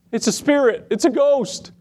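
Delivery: high, rising panic, "It's a ghost"; frightened disciples. Retold or imitated. Disciples in the boat seeing Jesus, mock scared cry. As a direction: shriek it like startled fishermen, half-mocking.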